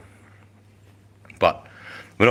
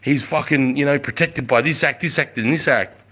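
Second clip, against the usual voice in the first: retold officer ordering them off, pitched higher; urgent officer; 6 semitones higher than usual. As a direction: bark it out like a major stepping in, voice up high. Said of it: "he grabbed me and he's like".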